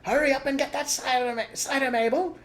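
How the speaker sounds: calling from upstairs. A narrator's reading. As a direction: call down the stairs. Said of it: "the voice called".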